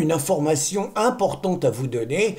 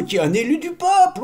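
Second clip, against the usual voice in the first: mock outrage; 10 semitones higher, faster than usual. Mock-pompous build-up about Loulou.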